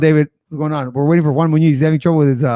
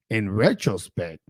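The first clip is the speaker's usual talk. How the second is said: "in retrospect" repeated lower and softer, to himself; quieter, lower repeat; 6 semitones lower, 5 dB quieter, slower than usual. Real, not acted. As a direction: murmur "in retrospect" a second time as an aside. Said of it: "I think he would have".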